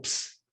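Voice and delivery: "ps" as p running straight into a hiss; P-S blend. Shown as a demonstration. Teacher demonstrating an ancient letter's sound, blended "ps". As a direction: say "ps" as one voiceless blend. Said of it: "P and S together".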